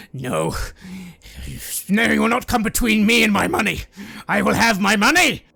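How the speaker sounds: high, loud greedy outburst; shouting to protect his money; furious refusal. Acted. Greedy man refuses loudly.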